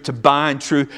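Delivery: ordinary delivery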